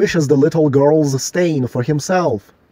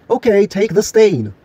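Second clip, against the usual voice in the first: higher-pitched mock order at gunpoint; mock threat; 6 semitones higher than usual. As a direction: issue a pretend threat, pitched up. Acts out Jack's armed threat.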